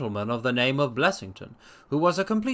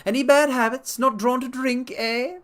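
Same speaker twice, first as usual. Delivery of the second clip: much higher, louder probing; Blessington voiced; 10 semitones higher, 4 dB louder than usual. A narrator's reading. Blessington probing the doctor's habits, high sharp voice. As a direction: poke at him, high and sharp. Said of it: "Blessington questioning".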